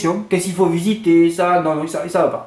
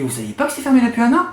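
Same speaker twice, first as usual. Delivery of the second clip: incredulous question; 6 semitones higher, faster than usual. Retold or imitated. Retold challenge to hotel receptionist.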